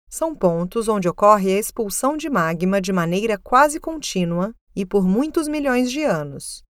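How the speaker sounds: ordinary delivery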